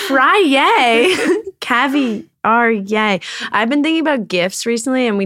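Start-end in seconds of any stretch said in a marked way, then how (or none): 0.1-3.2 excited cheer. Real, not acted. Cheering for caviar.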